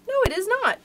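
high-pitched letdown, falling at the end; disappointed. A narrator's reading. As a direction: let the hope drop away, voice starting high. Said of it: "in a disappointed tone".

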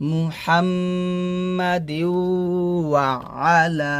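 drawn-out chanted Arabic; measured, sustained teaching recitation; slow recitation. Acted. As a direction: chant slowly, humming the doubled m.

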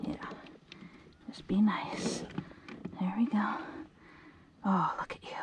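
soft hushed whisper to a fish. Real, not acted. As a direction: barely breathe the words, gently.

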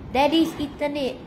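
plain reading voice

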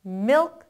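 milk with the closing k barely there; very weak final k. Shown as a demonstration. Teacher shows how weak the sound is, milk with a tiny k. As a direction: say milk in one strong syllable, k very faint. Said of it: "소리는 아주 약하게".

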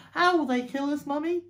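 high, loud little fox voice; small child's alarmed question; frightened child. Acted. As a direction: ask Mummy like a scared cub. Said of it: "huge with fright".

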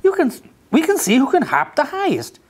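very high, bouncy rabbit call; a rabbit eager to hop. A narrator's reading. Rabbit inviting Duck to hop, very high. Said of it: "said Rabbit".

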